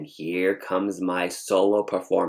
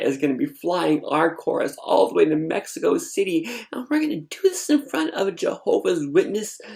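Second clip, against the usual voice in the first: high-pitched, incredulous inner protest; baffled disbelief; 9 semitones higher than usual. Retold or imitated. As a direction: climb higher in bewildered disbelief. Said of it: "I'm so confused".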